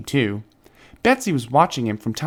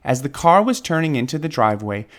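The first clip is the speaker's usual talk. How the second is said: ordinary delivery, nothing marked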